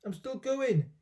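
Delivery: put-on high screech of an old woman; screechy old voice. A narrator's reading. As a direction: screech it down from the attic. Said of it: "the old screechy voice".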